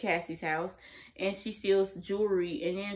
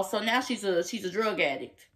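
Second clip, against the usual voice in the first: ordinary delivery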